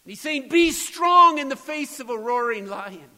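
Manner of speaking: preacher rising to full force; preaching at full force. Acted. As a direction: drive the question home to the congregation.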